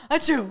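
loud playful achoo; sneeze imitation. Shown as a demonstration. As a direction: fake a big sneeze. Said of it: "you don't go".

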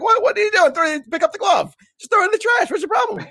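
exasperated rant at a stranger; exasperated scolding. Retold or imitated. Scolding a glove litterer.

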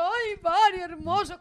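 high, loud mock gushing; imitating doting adoration. Wife mimics husband in love with the bird, gushing. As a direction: coo loudly, like someone besotted with a pet. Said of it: "Calé, enamorado del pájaro".

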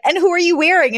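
high, eager interviewer voice; red-carpet reporter. Retold or imitated. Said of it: "then they're like".

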